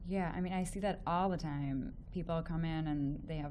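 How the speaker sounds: ordinary delivery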